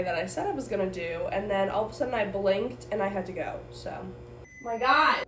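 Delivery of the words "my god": surprised. Real, not acted. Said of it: "I'm surprised it's hot".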